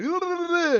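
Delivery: loud, high-pitched rapid babble; mock rapid Spanish. Streamer imagining himself as a Spanish YouTuber, mock rapid Spanish. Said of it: "fucking really fast".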